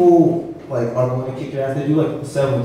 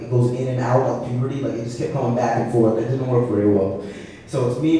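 ordinary talk, nothing marked

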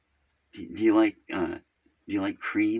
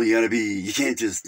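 usual speaking voice

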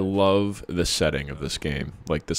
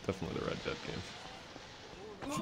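unvoiced quiet aside; whispered; 15 dB quieter than usual. Real, not acted. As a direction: whisper it under the breath. Whispered agreement.

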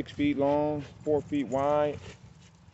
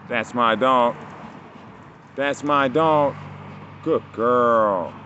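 loud, drawn-out praise for the dog; proud dog praise; 6 dB louder, much slower than usual. Real, not acted. Owner praising his dog Jelly, loud and proud. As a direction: cheer her on like a proud owner.

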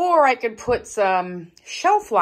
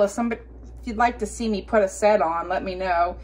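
ordinary talk, nothing marked

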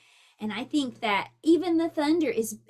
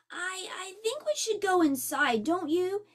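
higher, hesitant nervous turtle; 6 semitones higher than usual. Acted. Franklin nervously urging his friend to go inside, hesitant. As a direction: stammer it out nervously. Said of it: "Franklin pointed nervously".

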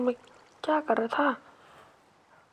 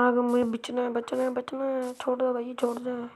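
usual speaking voice

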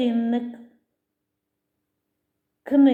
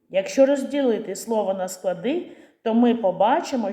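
ordinary talk, nothing marked

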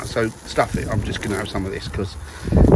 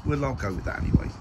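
ordinary talk, nothing marked

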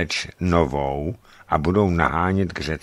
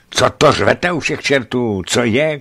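loud, higher exasperated shout; 6 semitones higher, 6 dB louder than usual. A narrator's reading. Narrator yelling at the frightened hunter, loud shout. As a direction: bark it at the hanging man. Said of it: "křikl jsem".

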